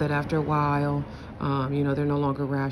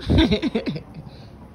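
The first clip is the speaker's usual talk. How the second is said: real laugh; 5 semitones higher, 3 dB louder than usual. Real, not acted. Laughing at mother.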